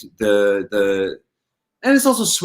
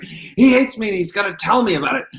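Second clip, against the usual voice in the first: mock panic; 9 semitones higher than usual. Retold panic about being rejected.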